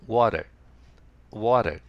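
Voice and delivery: American English "water" with a very light, almost silent T. Shown as a demonstration. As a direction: say "water" American-style, the T barely heard. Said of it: "Tの発音が非常に、あの、軽いんですね". (アメリカ英語、Tは軽く「わら」のように)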